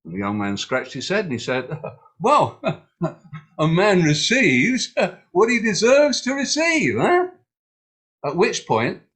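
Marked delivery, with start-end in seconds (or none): 2.2-7.3 cheeky laughing reply. Acted. Young man answers king cheekily.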